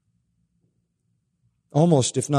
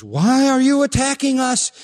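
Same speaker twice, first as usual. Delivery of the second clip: shrill demon pleading, higher and louder; panicked demon voice; 11 semitones higher, 4 dB louder than usual. Acted. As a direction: shout it out like a terrified demon. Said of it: "The demon says".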